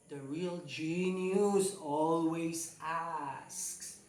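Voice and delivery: slow, weighty delivery of a famous quote; slow emphatic saying. Acted. Teacher quoting Einstein to the class, slow and weighty. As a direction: lay it down like a maxim.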